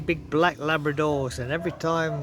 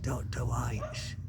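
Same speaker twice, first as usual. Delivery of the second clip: embarrassed quiet repeat; 4 semitones lower, 8 dB quieter, much slower than usual. Retold or imitated.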